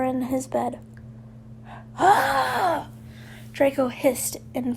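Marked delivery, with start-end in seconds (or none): 2.0-2.9 high, shouted cry of frustration; frustrated yell. A narrator's reading. Draco yelling in frustration in the dorm. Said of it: "more yelled at the top of his lungs".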